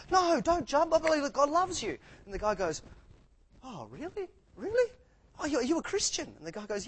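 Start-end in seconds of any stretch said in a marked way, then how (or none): 0.1-2.0 high, loud mock-desperate cry; acted frantic shout from a would-be rescuer; urgent plea. Acting rescuer pleading with jumper. 3.6-6.3 high-pitched, hammed-up disbelief; mock-astonished joke character; eager surprise. Acting delighted surprise in a joke.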